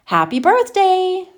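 high, loud, slow quavery cheer; old folks' cheer. A narrator's reading. Grandparents greet Charlie on his birthday, high slow cheer. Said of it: "cried the four old grandparents".

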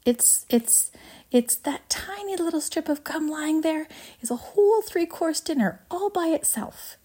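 high, eager showman's voice; breathless pride in a marvellous invention; proud, excited inventor. Acted. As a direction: build in wonder, pitch climbing as you marvel. Voices Wonka boasting of invention.

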